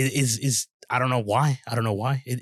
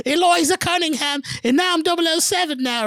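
very high, louder put-on voice; mock character impression; 4 dB louder than usual. Mimicking a co-host's made-up Bond heroine, high mock voice. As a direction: imitate the newcomer in a silly high pitch. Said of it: "or whatever he said".

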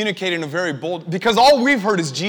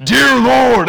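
booming mock soldier voice, higher and much louder; Roman soldier; 7 semitones higher, 12 dB louder than usual. Imitating the app's soldier suddenly cutting in, loud soldier voice. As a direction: burst in as an over-dramatic Roman soldier. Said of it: "narrator changes to be a Roman soldier".

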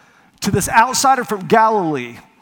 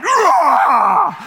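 loud, raised roar of pent-up frustration; frustrated roar; 7 semitones higher, 6 dB louder than usual. Retold or imitated. Rescuer at breaking point, loud wordless roar. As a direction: let the frustration burst out in a roar. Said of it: "all this frustration just mounts up".

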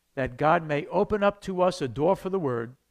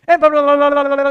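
mock rapid-fire witnessing; hammed-up auctioneer gabble; auctioneer patter; 9 semitones higher, 14 dB louder than usual. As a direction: rattle off blah blah like an auctioneer, very loud and high. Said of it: "You're almost like an auction".

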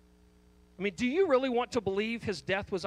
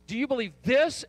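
"this" punched louder; stress on THIS; 4 semitones higher, 6 dB louder than usual. Shown as a demonstration. Preacher insisting on objective truth, stress on THIS. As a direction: drive the question onto "this". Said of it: "final phrase that may be emphasized here is this".

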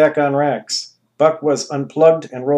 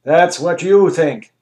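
smug ruler; 4 semitones higher, 7 dB louder, slower than usual. Acted. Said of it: "Anus replied".

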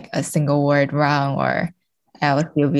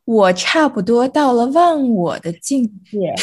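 put-on higher, sing-song child reciting 忘我, over-emoting; nine-year-old reading voice; 8 semitones higher, 4 dB louder than usual. Shown as a demonstration. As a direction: recite it like a schoolkid putting in too much emotion. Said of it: "read if I were, like, nine years old".

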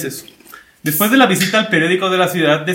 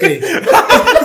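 a sudden loud laugh; 6 dB louder than usual. Real, not acted. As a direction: burst of high laughter. Laughs at co-host.

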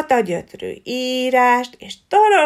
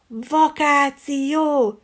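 drawn out very slowly; dreamy longing; 5 semitones higher, much slower than usual. Acted. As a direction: savour the word like a dream.

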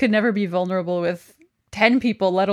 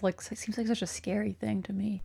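whispering; 13 dB quieter than usual. Real, not acted. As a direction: whisper it like a confession. Confiding a fear.

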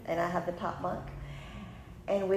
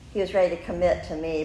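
ordinary talk, nothing marked

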